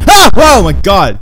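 high, loud startled shout; really loud outburst. Real, not acted. Player jolted by the game, really loud startled outburst. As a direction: blurt it out at full volume. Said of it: "Sorry, that was really loud".

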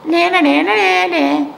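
high sing-song taunt. A narrator's reading. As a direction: tease the squirrels like a brat. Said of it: "taunting the squirrels".

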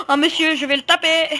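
high excited mock voice; imitating the enemy. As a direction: play the silly enemy player. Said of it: "Et le mec, c'est". (le joueur ennemi qu'il imite, voix aiguë moqueuse)

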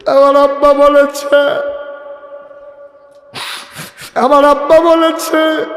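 high, loud, drawn-out cry; preacher acting a dying daughter's anguished call; wailing lament. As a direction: wail it out to the congregation. Dying Fatema cries to Ali.

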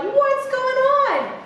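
high, slow bewildered question; confused parents. Retold or imitated. Parents coming home to a changed house, high and puzzled. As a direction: sound baffled on walking into the house. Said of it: "the parents are like".

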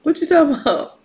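high, loud mock bafflement; hammed-up incredulous squawk; fronting denial. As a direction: act like you never heard of him. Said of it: "Like, he was fronting".

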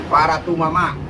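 ordinary talk

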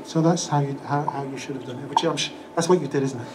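ordinary delivery

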